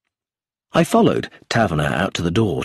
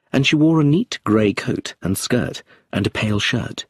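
ordinary delivery, nothing marked

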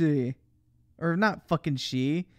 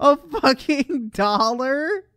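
slow, stretched, louder and higher; drawn-out disbelief; 10 semitones higher, 7 dB louder, much slower than usual. Acted. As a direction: drag every word out in mock shock. Stretched outraged repeat of dollar.

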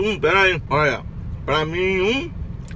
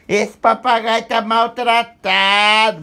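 mocking people's talk; 6 semitones higher, 5 dB louder, slower than usual. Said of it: "só faltava o povo falar".

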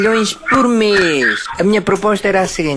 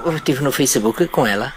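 disguised deep voice; 6 semitones lower, 3 dB quieter than usual. Pretend. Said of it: "vou mudar a voz".

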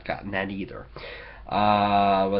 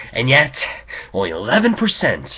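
loud, fast indignant emphasis; 6 semitones higher, 10 dB louder, faster than usual. Real, not acted. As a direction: punch it with outrage.

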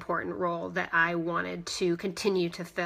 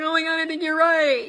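realizing; 8 semitones higher, 5 dB louder than usual. Retold or imitated. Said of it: "And I was like".

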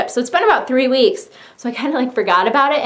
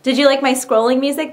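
ordinary talk

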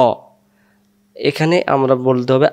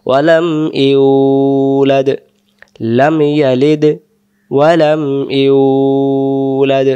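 slow, drawn-out chanted recitation; Quran recitation; much slower than usual. Shown as a demonstration. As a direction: intone each word slowly and melodically. Recitation demo.

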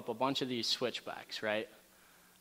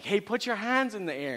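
raised, higher shout to friends on the ridge; retold yell; 9 semitones higher, 3 dB louder than usual. Yelling to his friends to raise their hands. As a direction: call out the warning, pitched up. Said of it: "And so I yell at the guys".

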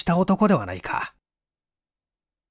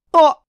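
startled gasp; 13 semitones higher, 9 dB louder than usual. A narrator's reading. Okamoto startled from behind.

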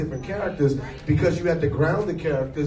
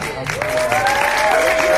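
a much higher, louder, stretched-out proud shout; exultant cry; 7 dB louder than usual. Real, not acted. Actor bursting with pride to the crowd, loud and high. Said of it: "And I'm proud".

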